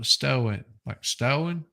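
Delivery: "stowen" with lips pursed out, long rounded o; Minnesota stone. Shown as a demonstration. Host teaching the Minnesota way to say stone, lips pursed. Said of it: "Gotta purse your lips out when you say stone".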